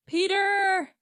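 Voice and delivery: loud, high call of his name; shouting again. A narrator's reading. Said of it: "his mother shouted again".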